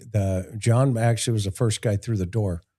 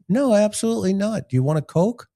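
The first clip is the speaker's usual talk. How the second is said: fake friendly; 8 semitones higher than usual. Cop plays friendly in interrogation.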